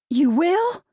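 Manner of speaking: high, loud, excited surprise. A narrator's reading. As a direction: sound thrilled and surprised. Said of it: "Carol sounded excited".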